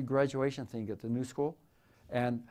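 usual speaking voice

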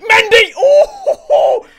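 high, loud yell at the screen; sudden yelp of excitement; excited shout. Real, not acted. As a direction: cheer the striker on, loud. Goal chance, shouting at player.

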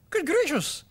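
high, loud amazed exclamation. Acted. Mother seeing the sunken rock seat, loud amazed cry. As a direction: burst out in astonishment. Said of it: "She looked amazed".

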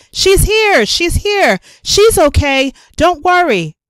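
high relieved shout; aunt calling out reassurance. A narrator's reading. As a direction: shout the good news across the street. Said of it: "waved and shouted".

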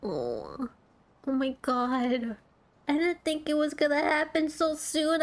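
ordinary talk, nothing marked

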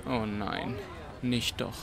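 soft, dismayed oh no; quiet inner dismay; dismayed murmur. Acted. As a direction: say it under your breath in dismay. Narrator's dismayed inner aside.

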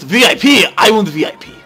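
loud, high clamouring; excited demand. Pretend. Demanding VIP entry in game.

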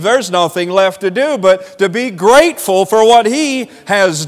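high, ringing pulpit delivery; fervent preaching. Acted. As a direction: lift the pitch and proclaim it to the congregation. Preaches on grateful believers.

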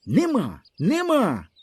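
high, insistent no, rising in pitch; boy screaming his denial at teasers; stubborn high cry. A narrator's reading. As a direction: refuse with everything you have. Boy's quarrel with the others.

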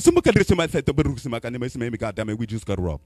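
rapid mock-African gibberish sliding into English; mock African accent. African roommate reports a robbery, frantic mock-accent babble. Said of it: "he speak half his language".

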